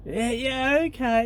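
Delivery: very high, eager lovestruck yes; an enchanted girl gushing agreement; spellbound girl. Pretend. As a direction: squeal the yes, high and entranced.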